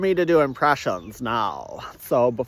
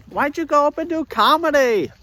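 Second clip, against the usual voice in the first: higher questioning voice, mock incredulous; imagined questioner; 11 semitones higher than usual. Skeptic asks the comedian why he did comedy, mock incredulous.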